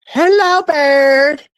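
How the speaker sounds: squeaky high call to the bird; mock high hello. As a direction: sing out a teasing high hello. Calling to a silent guest.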